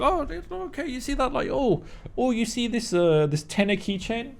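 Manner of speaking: high, soft, airy put-on voice of a careless seller; lightly mocking, softly high; mock vendor voice. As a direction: play a clueless vendor shrugging off theft.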